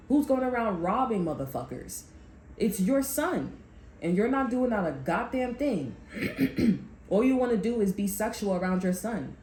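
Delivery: usual speaking voice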